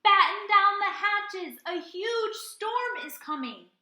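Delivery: loud, high, slow warning shout; urgent cry. A narrator's reading. Young bunny shouting a storm warning to the pirate crew. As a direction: bellow the warning to the whole crew. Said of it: "Henry cried".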